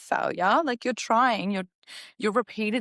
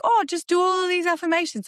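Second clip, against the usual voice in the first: high, mocking singsong of the affirmation crowd; airy mock voice mimicking people who push affirmations; 10 semitones higher, 4 dB louder than usual. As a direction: send up their breezy advice, pitched well up. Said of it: "when people are like".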